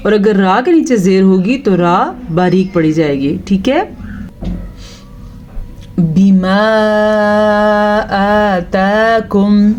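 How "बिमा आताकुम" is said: slow, drawn-out tajweed chant; slow melodic Quran recitation; Quranic recitation. Shown as a demonstration. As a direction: recite with long, measured vowels. Recites verse.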